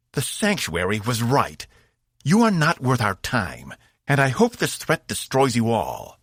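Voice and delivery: a proud fighter betrayed and furious; angry alien warrior. A narrator's reading. Zenfor scorns the crew.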